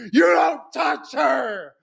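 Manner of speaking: high, slow, strained shout; heroic shout. Retold or imitated. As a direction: yell it like a hero protecting her. Jack defending Florna at gunpoint.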